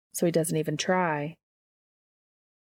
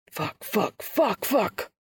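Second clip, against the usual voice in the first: whispered curses breaking into a higher outcry; panicked cursing; 4 semitones higher, slower than usual. A narrator's reading. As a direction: start in a breath, burst out on the last. Dean's panicked outburst on waking.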